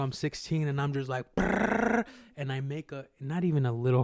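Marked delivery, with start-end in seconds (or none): 1.3-2.0 high buzzing trilled saw imitation; imitated saw, high rolled brrr; power saw noise. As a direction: trill out a loud-running saw sound.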